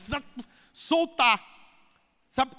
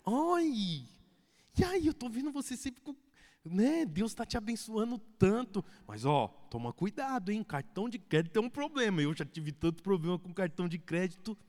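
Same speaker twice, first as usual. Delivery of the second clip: much softer, honeyed compliment then caution; sweet tactful advice; 6 dB quieter than usual. Retold or imitated. Cell leader gently warns wife about credit card, sweetly. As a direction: flatter first, then warn kindly. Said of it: "Aí a líder ... fala assim".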